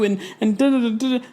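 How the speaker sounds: high mock babble, brushing her off; dismissive da da da. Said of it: "She's just like".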